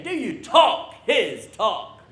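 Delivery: loud, slow prompt to the crowd; emphatic call-out. Shown as a demonstration. Preacher gets the congregation to say it to a neighbor, emphatic call-out. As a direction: punch it out slowly for them to echo. Model line for congregation to repeat.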